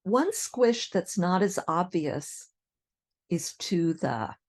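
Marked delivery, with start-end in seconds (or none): none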